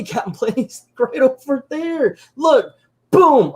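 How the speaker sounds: high, laughing mock protest; frantic kids. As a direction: point out the villain in a panicky kid voice. Improvised kids still protesting to hero.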